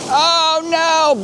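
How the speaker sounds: real alarm as the sea comes in. Real, not acted. Vlogger caught by a wave, alarmed cry.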